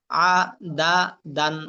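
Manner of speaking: আ, দা, দান each on its own; broken syllables. Shown as a demonstration. Teacher drilling learners in broken pronunciation, syllable by syllable. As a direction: split আ, দা, দান with pauses between. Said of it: "ভাঙ্গা উচ্চারণ করবেন একটা একটা করে".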